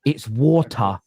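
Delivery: "water" with the t fully sounded; correcting daughter. Retold or imitated. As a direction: pronounce the t in "water" crisply, correcting Daddy. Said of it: "I get corrected by my daughter".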